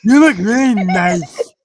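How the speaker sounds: big guy voice. Retold or imitated. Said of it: "This big boy walks in and goes".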